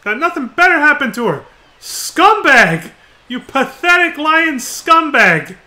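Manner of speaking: furious full shout, high and very loud; pissed off. Acted. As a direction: explode at someone who failed you, drawing out the insults. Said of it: "He's pissed".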